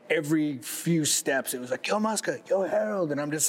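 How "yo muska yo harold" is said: high, mock passersby hollering names; calling out. Retelling fans greeting Muska on the street, mock call out.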